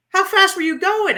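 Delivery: a friend asking in disbelief how fast she went; amazed question. Retold or imitated. Keith surprised by her speed.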